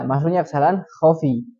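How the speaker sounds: normal talking voice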